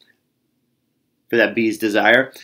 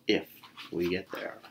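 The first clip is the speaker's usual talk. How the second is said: quiet; 6 semitones lower, 16 dB quieter than usual. A narrator's reading. Doubtful aside, very soft.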